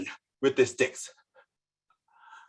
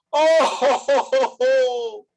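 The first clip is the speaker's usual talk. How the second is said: wailing oh ho; 11 semitones higher, 5 dB louder, slower than usual. Acted. Mourning cry chant.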